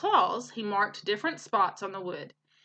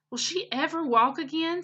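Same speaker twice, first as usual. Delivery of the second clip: raised, worried little goose asking; higher voice for Bright Bill; 6 semitones higher than usual. Acted. Teacher voicing Bright Bill asking about Roz, higher and worried. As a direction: lift the pitch and ask anxiously.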